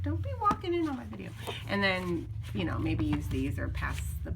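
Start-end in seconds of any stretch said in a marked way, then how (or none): 0.0-1.3 higher, fast mock scold; playful scold at the husband. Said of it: "I was like".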